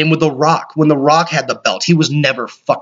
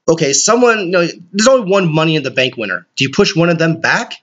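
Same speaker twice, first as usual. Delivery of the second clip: ordinary talk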